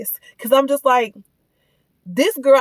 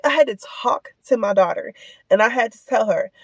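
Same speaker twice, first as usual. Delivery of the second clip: ordinary talk, nothing marked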